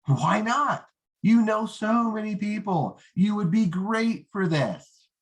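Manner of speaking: a needy recruiter pleading a little; eager rookie pitch. Shown as a demonstration. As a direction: sound overeager and pushy. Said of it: "The rookie would say".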